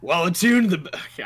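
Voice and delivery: high wrestler voice, breaking off; Hogan. Acted. Game master reading rules, strained wrestler impression. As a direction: strain at the wrestler voice until it gives out. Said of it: "I can't keep doing".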